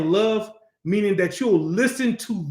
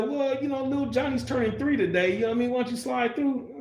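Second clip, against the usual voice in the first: lightly voiced inviter, higher and softer; acted casual invitation; 7 semitones higher, 4 dB quieter than usual. Relative inviting someone to little Johnny's birthday, lightly acted casual invitation. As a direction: pitch it up, a light mock coaxing invite.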